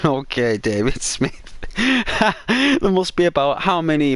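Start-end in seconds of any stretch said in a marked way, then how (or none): 1.6-2.4 a quick high burst of laughter; short high giggle at the joke. Real, not acted. Laughs at name guess.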